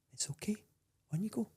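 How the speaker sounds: soft, high, gentle "it's okay"; gentle reassurance. Retold or imitated. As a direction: imitate a kind stranger soothing you. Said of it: "he was so gentle".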